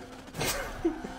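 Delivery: high breathless laughing fit; wheezing laugh. Real, not acted. Laughing at crash.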